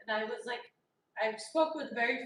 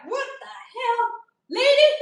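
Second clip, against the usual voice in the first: loud, high, outraged "What the hell? Lady?"; retold angry yell; 11 semitones higher, 14 dB louder than usual. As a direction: blow up at the receptionist, voice soaring. Yelling at receptionist on phone.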